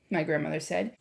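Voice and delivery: plain reading voice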